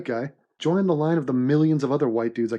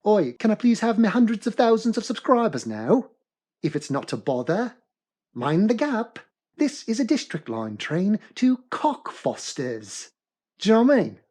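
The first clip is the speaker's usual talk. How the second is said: put-on Cockney accent; 7 semitones higher than usual. Comic begging for subscribers in a hammed-up Cockney accent. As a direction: overdo a London Cockney voice. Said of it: "with an accent".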